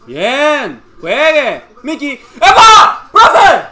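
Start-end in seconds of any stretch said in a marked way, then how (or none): 2.3-3.7 loud, high two-burst shout; shouted appeal. Real, not acted. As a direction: yell at the referee in protest. Appeals to referee. (gritos de reclamo al árbitro)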